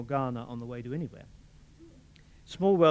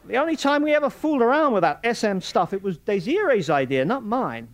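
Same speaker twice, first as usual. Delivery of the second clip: louder, higher, fast blustering man; blustering Morris; 10 semitones higher, 10 dB louder than usual. A narrator's reading. Morris fends off Fulvia in loud, fast bluster. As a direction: brush her off with quick bluster.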